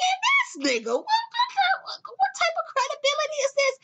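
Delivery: outraged disbelief. Retold or imitated. Reacting to [name]'s claim, laughing outrage. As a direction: squeal in disbelief. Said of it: "And I'm like".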